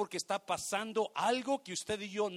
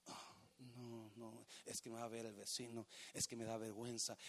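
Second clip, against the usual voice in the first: mock shy excuse; 11 semitones lower, 20 dB quieter than usual. Congregant's excuse voiced by preacher.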